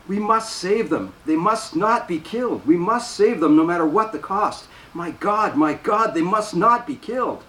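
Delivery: high, urgent, slowed pleading for the sisters; desperate pleading. A narrator's reading. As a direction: beg as if panic is rising. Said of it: "She repeats over and over".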